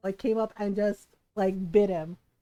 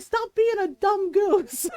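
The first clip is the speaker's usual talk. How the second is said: high, slow, exasperated scolding; retold yell; 9 semitones higher, 5 dB louder, slower than usual. Cousin tells off aunt's goose, drawn-out mock yell. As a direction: imitate the cousin yelling at a goose. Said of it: "he yelled at her".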